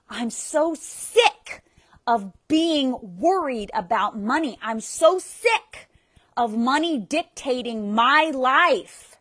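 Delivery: slow, high, loud exasperated outburst; fed up. Shown as a demonstration. Coach venting money worry for the listener, loud and exasperated. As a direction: lean hard on "so", sick of it all. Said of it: "maybe you wanna say something like".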